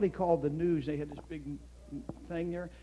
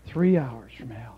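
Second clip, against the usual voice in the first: slow, hushed whisper; a slow breathy whisper after a long pause; 5 semitones lower, 4 dB louder, slower than usual. Acted. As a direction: let it fall to a grave whisper. Dramatic pause in sermon story.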